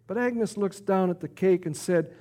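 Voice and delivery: usual speaking voice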